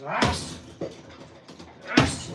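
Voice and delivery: short forceful grunts on each punch; mock boxer grunts; grunting punches. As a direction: grunt like a boxer throwing shots. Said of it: "he used to like this".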